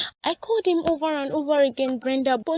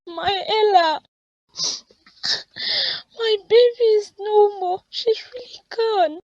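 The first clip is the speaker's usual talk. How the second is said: higher, tearful crying; weeping; 5 semitones higher than usual. Acted. Grieving mother to her friend, weeping. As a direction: cry out for the lost child. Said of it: "Richard isn't worth your tears".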